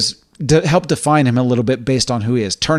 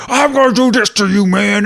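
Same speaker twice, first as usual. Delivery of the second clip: much higher, louder hammed-up promo yell; phony wrestling promo; 7 semitones higher, 4 dB louder than usual. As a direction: ham up a fake tough-guy wrestling promo. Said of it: "that phony version of him".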